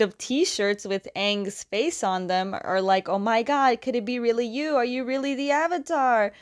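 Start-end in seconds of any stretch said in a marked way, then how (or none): 3.1-6.3 higher-pitched mock starstruck fan; gushing fangirls. Two girls spotting the Avatar, gushing. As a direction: imitate fans thrilled to meet their idol. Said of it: "just, like, gushing over Aang".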